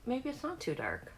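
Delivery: usual speaking voice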